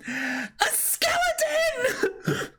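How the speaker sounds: very high, slow laughing shriek. Acted. Character spots the skeleton, very high shriek. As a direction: scream at the skeleton.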